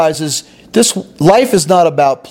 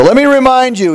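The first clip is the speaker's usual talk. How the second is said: high, sneering "let me remind you"; mock accusing; 9 semitones higher, faster than usual. Older son throws the sin back at father, sneering. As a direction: needle him like a self-righteous older brother.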